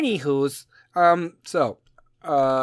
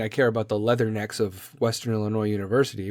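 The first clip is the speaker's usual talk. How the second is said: ordinary talk, nothing marked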